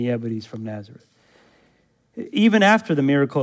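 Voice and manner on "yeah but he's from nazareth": dismissive crowd. Retold or imitated. Crowd voiced dismissing Jesus.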